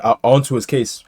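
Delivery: normal talking voice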